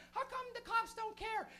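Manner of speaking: high, slow mock old-lady whine; complaining old lady. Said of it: "some old lady was complaining".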